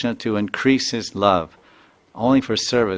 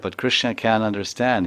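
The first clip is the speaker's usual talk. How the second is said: ordinary talk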